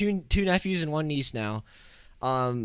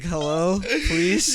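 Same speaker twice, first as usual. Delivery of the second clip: pleading phone voice; 5 semitones higher, much slower than usual. Retold or imitated. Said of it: "picking up those scam likely calls".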